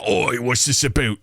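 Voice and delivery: slow regional British attempt; slipping British dialect. Shown as a demonstration. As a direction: botch a British accent, "about" as "aboot". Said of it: "slip into different regional dialects".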